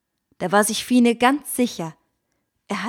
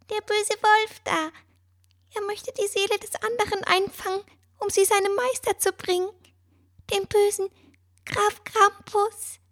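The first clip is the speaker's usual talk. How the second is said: high, fast piping bird voice; squeaky little bird; 13 semitones higher than usual. A narrator's reading. Bird explains the evil count to Fine, fast high piping voice. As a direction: chatter it quickly like a tiny bird. Mister Thompson speaks.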